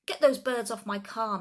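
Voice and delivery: loud angry bellow. A narrator's reading. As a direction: roar the order across the yard. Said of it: "Mister Hazel bellowed".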